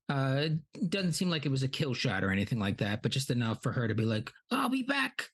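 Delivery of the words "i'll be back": high, far-off monster voice vowing to return; Shelob's parting threat. Retold or imitated. Spider voiced to Frodo, high mock villain vow. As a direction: squeak out a villain's retreat line.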